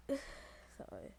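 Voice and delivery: normal talking voice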